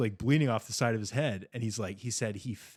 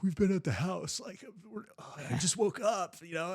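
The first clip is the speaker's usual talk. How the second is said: high, falsely innocent voice; fake innocent; 9 semitones higher than usual. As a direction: play dumb and sleepy. Fake innocent excuse to mom.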